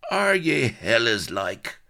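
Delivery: high, loud, irritated retort; stretched-out scornful snap; snapping. A narrator's reading. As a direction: snap it back in a brogue. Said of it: "snapped the hanging figure".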